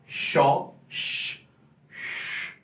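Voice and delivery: whispered "श", a long hushed sh; "शॉ, श" with breath only, no voice; sh sound demo. Shown as a demonstration. Teacher whispering the sh sound for the class. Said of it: "ये, ये sound".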